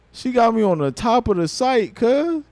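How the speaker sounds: bursting with amazement; excited disbelief. Real, not acted. Amazed at being on top.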